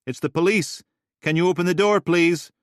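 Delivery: loud and high, calling out; calling through a door. Acted. Tufty calling through the door to the resident.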